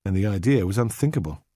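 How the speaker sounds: plain reading voice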